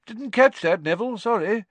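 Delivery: put-on breezily encouraging the shy boy; cheerful. A narrator's reading. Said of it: "said Professor Lupin cheerfully".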